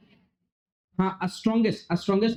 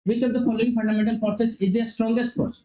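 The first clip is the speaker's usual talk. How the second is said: slow read-aloud; 4 semitones higher, much slower than usual. Acted. Reads question aloud.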